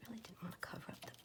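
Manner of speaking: hushed, breathy ASMR murmur. Acted. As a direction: whisper it close to the mic. Whispered crafting commentary.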